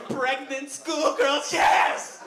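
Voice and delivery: high, slow, loud exultation; lustful glee. Acted. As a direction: shout it out in mock ecstasy. Acting out frenzied late-night searching.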